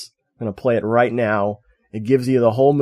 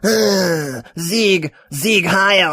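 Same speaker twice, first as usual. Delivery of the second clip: higher evil elf voice; 6 semitones higher, much slower than usual. Shown as a demonstration. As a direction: bark it like a movie elf. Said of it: "Yeah, pretty good".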